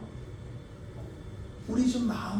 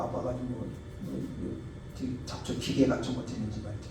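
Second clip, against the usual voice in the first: normal talking voice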